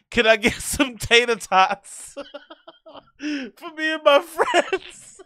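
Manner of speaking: high, slow, silly customer ordering through giggles; mock bar order. Imitating someone ordering tater tots at a bar, laughing. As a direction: play the sheepish customer, laughing. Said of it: "How do you fucking order that".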